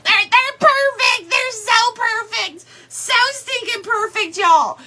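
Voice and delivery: high, thrilled squeal of delight; gushing excitement. Real, not acted. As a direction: gush over how perfect they are. Gushing over the pieces.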